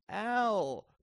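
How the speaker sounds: high, loud, hurt appeal; wounded cry. A narrator's reading. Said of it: "cried Greta in a wounded voice".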